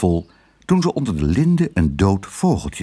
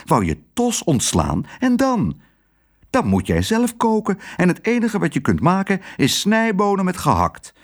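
alarmed; 10 semitones higher than usual. A narrator's reading. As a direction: voice climbing high in dismay. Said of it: "riep haar man verschrikt". (verschrikt protesteren)

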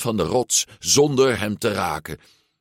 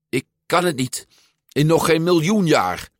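higher, emphatic refusal; blunt refusal; 9 semitones higher, 4 dB louder than usual. A narrator's reading. Boy refusing the ride downhill, blunt refusal. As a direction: dig your heels in. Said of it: "antwoordde Luca botweg".

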